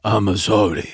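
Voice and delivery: low mock Japanese accent. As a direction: put on a hammed-up Japanese accent. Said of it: "in a Japanese accent".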